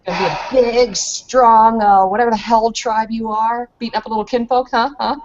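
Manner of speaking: cocky, quick mocking jeer; taunting. Kinfolk taunts the big tribesman, mocking and quick. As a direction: goad the big brute. Taunting a bigger fighter in character.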